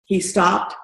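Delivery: ordinary talk, nothing marked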